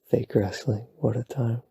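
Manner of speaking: usual speaking voice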